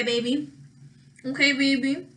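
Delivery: normal talking voice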